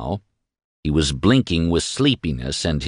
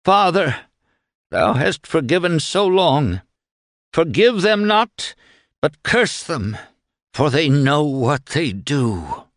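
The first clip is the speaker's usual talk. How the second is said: higher, slow, sweet crying-out prayer; sweet fervent prayer; 7 semitones higher than usual. A narrator's reading. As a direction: lift it up to heaven, slow and sweet. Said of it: "with such sweetness in his voice".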